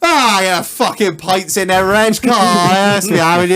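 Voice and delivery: high, loud mock mate voice; imitating a mate. Telling the podcast how Marlon turned up, mimicking him.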